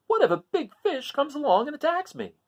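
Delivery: worried crab. Acted. Hermit Crab alone on the open seafloor, high and worried. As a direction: fret aloud like a nervous little crab. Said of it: "he thought".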